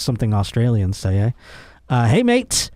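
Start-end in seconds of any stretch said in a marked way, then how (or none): 2.1-2.7 high-pitched, clumsy Australian impression; bad Australian accent. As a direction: have a failed go at Australian, pitched well up. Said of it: "I can't do an Australian".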